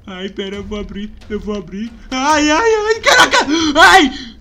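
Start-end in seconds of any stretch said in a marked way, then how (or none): 2.1-4.4 high, loud scared cry; frightened scream. Real, not acted. Gamer screaming at a jump scare.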